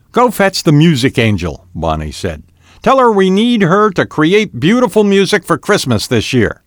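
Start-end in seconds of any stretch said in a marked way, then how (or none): 0.1-1.7 high, loud urging; eager order. A narrator's reading. Bonnie gives excited orders. 2.8-6.6 high-pitched, emphatic instruction; eager order. A narrator's reading. Bonnie gives excited orders.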